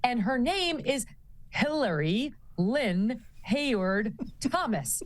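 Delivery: normal talking voice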